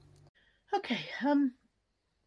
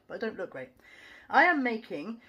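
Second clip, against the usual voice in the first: normal talking voice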